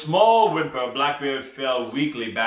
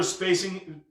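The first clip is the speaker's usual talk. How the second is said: ordinary delivery, nothing marked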